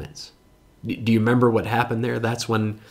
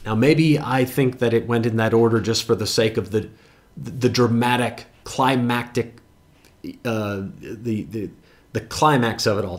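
normal talking voice

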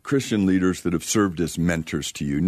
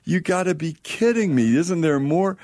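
high, incredulous protest; frustrated disbelief; 9 semitones higher than usual. Retold or imitated. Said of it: "I was like".

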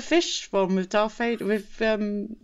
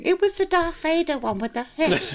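mock blurted answer; 7 semitones higher than usual. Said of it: "what happened here by me going".

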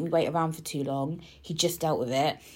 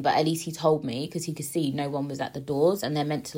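usual speaking voice

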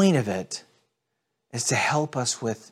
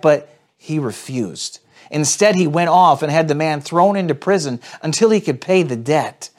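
louder, driven reading of the parable; dramatic scripture reading; 7 semitones higher, 7 dB louder than usual. Acted. Pastor reading the parable to viewers, dramatic scripture reading. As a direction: read it aloud like a preacher building the story.

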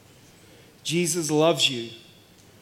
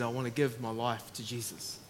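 quiet sincere reply; 8 semitones lower, 11 dB quieter than usual. Retold or imitated.

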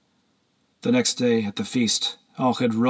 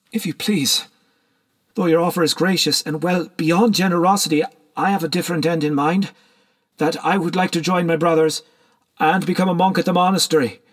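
higher-pitched, courteous declaration; polite young petitioner; 7 semitones higher than usual. A narrator's reading. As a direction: decline a gift respectfully but firmly before company.

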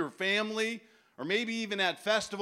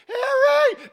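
very high, loud cartoon yell; mock shout; 12 dB louder than usual. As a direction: scream the name like the movie character. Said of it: "And he's like".

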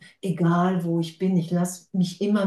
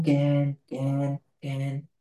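mock yawn; 4 semitones lower, 4 dB quieter, much slower than usual. Mock yawning at endless separation.

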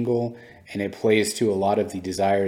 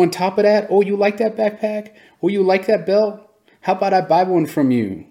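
much higher and louder, breezy and generous; friendly big kid; 6 semitones higher, 7 dB louder than usual. Retold or imitated. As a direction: play the cool big kid winning friends over. Other big kid being friendly.

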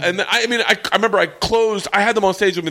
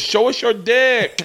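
mock heckler girl; 6 semitones higher, slower than usual.

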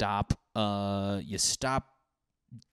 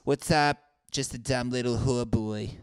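mock hooker voice; 3 semitones higher than usual. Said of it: "any hookers that sound like that?".

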